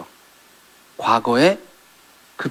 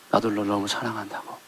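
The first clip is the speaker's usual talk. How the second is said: soft loving reply; 5 semitones lower, 11 dB quieter than usual. Retold or imitated. Jesus's voice quoted softly.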